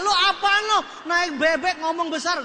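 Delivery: loud, high mock challenge; angry heckler. Audience member confronts the big-talking speaker, loud mock scorn.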